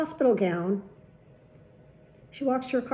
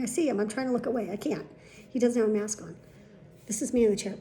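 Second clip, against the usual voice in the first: plain reading voice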